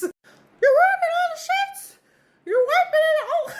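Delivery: high-pitched squeaky mom yelling; shrill cartoon-mouse rant, loud and high; Mickey Mouse mom. Mom scolding her son, high squeaky Mickey Mouse yell. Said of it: "She sounded like Mickey Mouse when she got mad".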